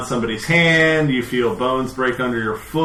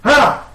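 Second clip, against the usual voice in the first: high startled cry; startled yelp; 9 dB louder than usual. A narrator's reading. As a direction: yelp as flame hits. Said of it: "they kind of, like".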